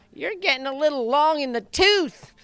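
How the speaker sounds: high, needling imitation of the boss; high-pitched chief. Chief scolds the jockey for not digging in, high-pitched mock. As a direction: tease the aging jockey in the chief's thin voice. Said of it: "he had that kinda high-pitched voice".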